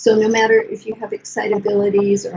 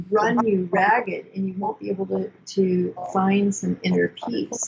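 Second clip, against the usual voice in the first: normal talking voice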